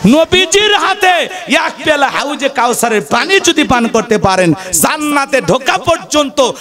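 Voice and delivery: ringing sermon shout that drops back down; preaching shout. Real, not acted. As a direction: cry out the Prophet's cup like a call to the crowd. Preacher hammers key phrase.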